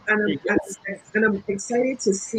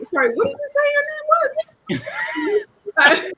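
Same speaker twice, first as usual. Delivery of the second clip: surprised question; 3 dB louder than usual. Retold or imitated.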